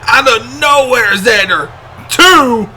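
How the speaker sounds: excited count. Real, not acted. Near fall called live.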